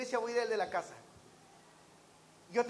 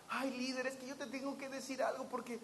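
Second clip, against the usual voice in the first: softer, sneaky tattletale voice; hushed tattling; 9 dB quieter, faster than usual. Retold or imitated. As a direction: tell on someone quietly. Mocking tattletale. (chismoso en voz baja)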